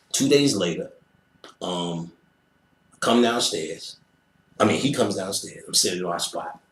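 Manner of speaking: usual speaking voice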